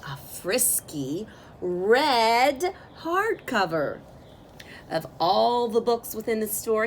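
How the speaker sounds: ordinary delivery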